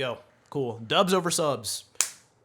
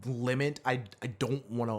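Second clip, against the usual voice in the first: ordinary talk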